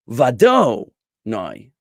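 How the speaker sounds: "vado noi" with DO punched loud and long; slow it down and hit "do" in "vado"; wrong stress on DO. Shown as a demonstration. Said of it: "That do is gonna be de-emphasized".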